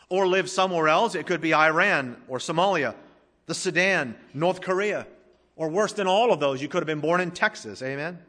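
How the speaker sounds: fervent sermon climax; preaching at full force. Acted. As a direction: drive each question home to the congregation. Preaching list of countries.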